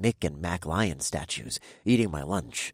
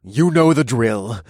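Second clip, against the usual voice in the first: weary sigh; 4 semitones higher, 9 dB louder, slower than usual. Acted. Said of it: "the deep voice sighed".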